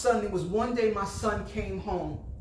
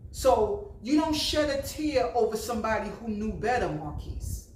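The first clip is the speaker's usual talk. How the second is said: ordinary delivery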